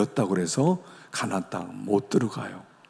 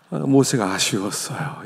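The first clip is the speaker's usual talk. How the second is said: usual speaking voice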